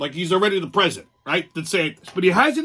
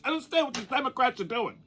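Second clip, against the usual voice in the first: imitating Kennedy; 4 semitones higher, 6 dB quieter, faster than usual.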